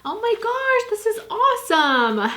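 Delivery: very high, thrilled oh my gosh; excited squeal. Real, not acted. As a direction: burst out in delight. Reacting to the children's book.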